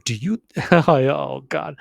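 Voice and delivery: ordinary talk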